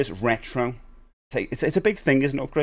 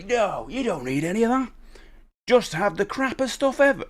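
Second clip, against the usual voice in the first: mock advice voice; 9 semitones higher than usual. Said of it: "when people say".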